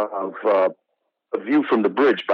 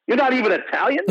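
retold question, pitched much higher in shock; stunned disbelief; 12 semitones higher than usual. Michael J. Fox to the actor, stunned and disbelieving. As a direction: ask it as if you can't believe it.

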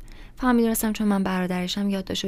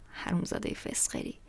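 whispered aside, much softer; under-breath insult; 8 dB quieter than usual. A narrator's reading. Narrator cursing the lying kid under his breath.

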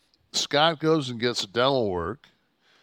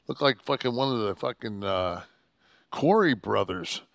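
normal talking voice

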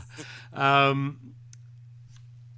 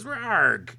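monster roar; 8 semitones higher, 5 dB louder than usual. Acted. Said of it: "he says".

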